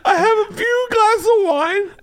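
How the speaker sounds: high, slow giggly telling; laughing while talking. Real, not acted. Comedian laughing through his own raffle story.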